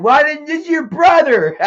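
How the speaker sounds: high, loud scolding, cracking into a laugh; mock mom yelling. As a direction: play mom scolding but can't keep a straight face. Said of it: "my mom yelled at me".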